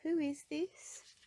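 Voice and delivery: high, slow trembling question. Acted. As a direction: ask in fear of another roof. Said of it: "she asked in a trembling voice".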